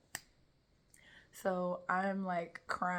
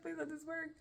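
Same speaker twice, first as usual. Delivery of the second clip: high-pitched plea; 10 semitones higher than usual. Retold or imitated. Repeats the retold plea after laughing.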